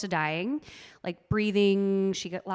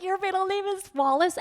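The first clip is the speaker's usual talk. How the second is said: high, incredulous mocking question; making fun; 11 semitones higher than usual. Girlfriend teases boyfriend about his middle name, high mocking question. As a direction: pitch it way up, poking fun at the name. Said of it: "I remember making fun of him".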